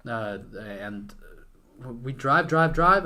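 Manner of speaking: ordinary talk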